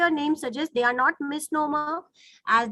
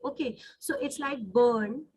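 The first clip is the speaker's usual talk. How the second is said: usual speaking voice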